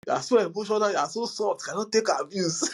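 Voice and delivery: lightly voiced taunt; mocking insulter. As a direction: imitate a sneering troll lightly.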